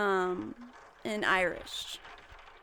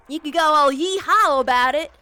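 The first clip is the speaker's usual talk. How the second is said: mock Southern yeehaw; 6 semitones higher, 10 dB louder than usual. Chatting with viewers, a loud mock Southern twang. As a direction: play up a cowboy twang, teasing.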